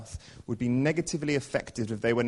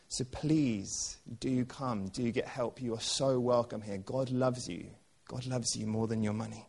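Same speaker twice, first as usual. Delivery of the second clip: tender reassurance; 8 dB quieter than usual. Real, not acted. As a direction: gently reassure the struggling listeners. Pastoral appeal to the struggling.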